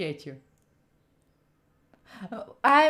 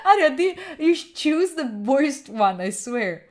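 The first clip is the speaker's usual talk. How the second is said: usual speaking voice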